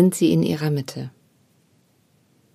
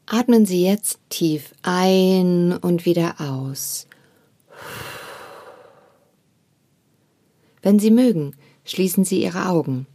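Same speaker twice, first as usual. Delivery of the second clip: guided meditation. Acted. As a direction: guide the listener gently into stillness. Leads a meditation.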